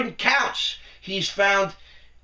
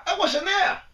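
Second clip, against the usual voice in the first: much higher, whiny put-on denial; mock innocent excuse; 10 semitones higher than usual. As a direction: squeak out the excuse like a caught kid. Mimicking the congressman's excuse.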